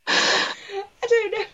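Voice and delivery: high-pitched, faux-innocent puzzlement; mock bafflement. Comic joking about her stage fright, mock bafflement.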